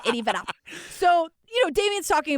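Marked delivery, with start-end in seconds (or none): 0.1-0.4 quick imitated New Zealand take on the name; New Zealand accent. Host recalls how the filmmakers said it, New Zealand accent. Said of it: "That New Zealand accent".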